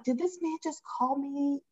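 can't believe what you just heard; stunned disbelief. Retold or imitated. Disbelief at being insulted.

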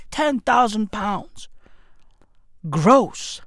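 low, slow and soft, weighing the figure; mock solemn. Repeating the wage, mock gravity.

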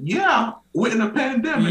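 high-pitched incredulous comeback; mock incredulous. Patient answering doctor in a pandemic, mock incredulity. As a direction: state the obvious, voice way up.

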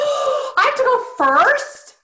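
gasp, then high, slow disbelief; shocked dismay. Real, not acted. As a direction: balk at being put on the spot. Dreads being picked first.

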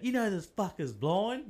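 spring the news slowly for effect; mock revelation. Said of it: "the story comes out".

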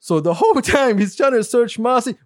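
ordinary talk

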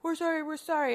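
high-pitched imitation of a panicking kid; freaked-out child. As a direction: mimic frantic, repeated pleading. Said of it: "my youngest one was freaking out".

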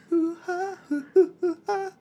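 high-pitched hooting; mimicked mocking noises. Son answering angry dad with bad lip reading, silly hooting. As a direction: hoot it high in silly imitation. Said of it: "that bad lip reading".

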